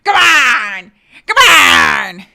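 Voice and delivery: ordinary delivery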